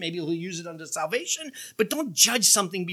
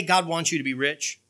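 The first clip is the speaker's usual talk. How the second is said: ordinary delivery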